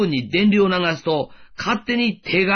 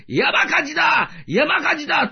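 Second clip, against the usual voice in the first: re-enacted alarm shout; 9 semitones higher than usual. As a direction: call out as if raising the fire alarm. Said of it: "と大騒ぎしました". (大騒ぎで知らせる叫び声の再現)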